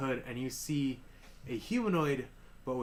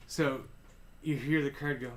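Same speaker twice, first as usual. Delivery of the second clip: usual speaking voice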